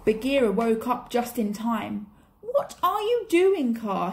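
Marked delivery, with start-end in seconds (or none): 2.4-4.1 high, louder alarmed shout. A narrator's reading. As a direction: shout to stop the snake. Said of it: "he shouted".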